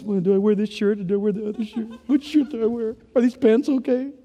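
quick, fussy self-mock about shirts; a light hurried fret; mock-anxious dithering over what to wear; fretting over clothes. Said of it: "This morning I was".

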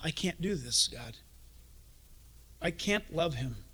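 quiet hopeless prayer; despairing plea. Acted. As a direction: give up, voice sinking. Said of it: "that impossible moment".

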